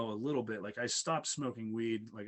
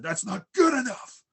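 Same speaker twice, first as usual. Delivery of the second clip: high, loud yell after breaking free; retold defiant yell; 10 semitones higher, 11 dB louder than usual. Patient yelling at the paramedic after breaking the bar, loud defiant yell. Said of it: "and just said".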